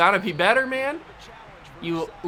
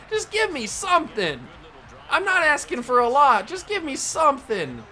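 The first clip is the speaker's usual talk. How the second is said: whiny pleading; 7 semitones higher than usual. Real, not acted. Pleading at the game.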